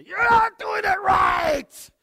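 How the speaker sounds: put-on high, loud exasperated yell at the kids; frustrated shout. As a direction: bark it out like a fed-up parent. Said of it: "it's really easy for us just to say".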